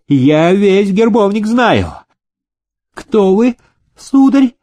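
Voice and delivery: very high, indignant challenge; puffed-up, high-pitched and drawn out; insistent petty nobleman. A narrator's reading. Nobleman challenging Demidov's claim to rank, insistent. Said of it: "не унимался проезжий".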